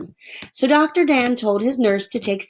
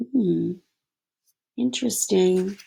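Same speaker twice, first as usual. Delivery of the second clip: ordinary delivery, nothing marked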